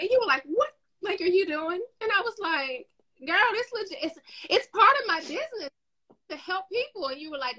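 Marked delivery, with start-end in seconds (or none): 0.4-1.8 high-pitched mock disbelief; incredulous challenge. Friend's challenge acted out. 3.3-7.1 high-pitched defensive insistence; protesting. Acted. Retelling herself defending her helping.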